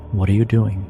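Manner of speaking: ordinary talk, nothing marked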